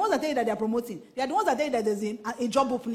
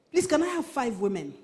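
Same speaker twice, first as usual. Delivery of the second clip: ordinary delivery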